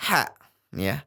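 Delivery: usual speaking voice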